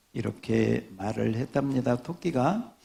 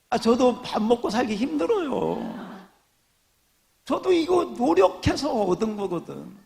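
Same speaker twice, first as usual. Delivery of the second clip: much higher playful whine; mock whining; 9 semitones higher than usual. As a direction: fish for sympathy, hamming it up. Pastor mock-complains for laughs.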